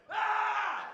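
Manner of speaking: put-on high, sustained kid's scream; mock scream. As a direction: imitate a boy screaming in someone's face. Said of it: "he screamed in his face".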